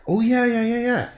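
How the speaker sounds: suddenly thrilled, it comes back; excited yeah. Real, not acted. Excited about remembered composition.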